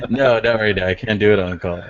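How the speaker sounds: ordinary talk, nothing marked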